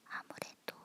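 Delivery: usual speaking voice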